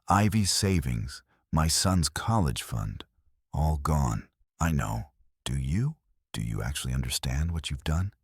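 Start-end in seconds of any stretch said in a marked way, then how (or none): none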